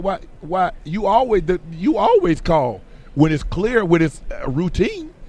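anxious retelling. Retold or imitated. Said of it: "I'm like".

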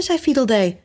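usual speaking voice

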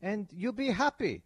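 over-the-top bad Russian accent. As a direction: command it like a cartoon Russian dictator. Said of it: "that's my bad Russian accent".

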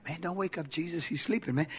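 low, hushed warning; soft, low aside to a friend; hushed disciple. Acted. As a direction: keep it down so he stays asleep. Acts out disciples' exchange.

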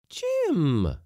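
high, loud hail; calling out. Acted. Lady cook arriving at the jetty, calling out. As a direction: sing out his name, drawn down.

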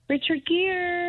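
high, slow call for a pet. Real, not acted. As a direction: sing out the name to lure it. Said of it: "Can you call for the gerbil".

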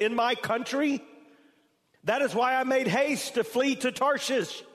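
high, loud impassioned scripture reading; preaching at full force. Acted. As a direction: pour out Jonah's angry prayer, then let it sink. Preacher reads Jonah's angry prayer.

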